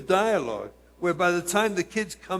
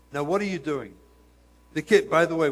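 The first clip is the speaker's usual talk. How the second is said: ordinary talk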